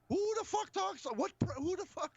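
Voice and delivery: high-pitched who the fuck, pitch jumping way up; shocked outburst. Retold or imitated. Friend reacting to a clip, high-pitched shocked outburst. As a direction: squeal of stunned disbelief. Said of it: "I was like".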